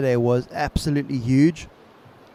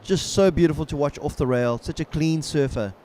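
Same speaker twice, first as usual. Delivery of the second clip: laid-back surfer announcer; 2 semitones higher than usual. Acted. Live play-by-play of ride.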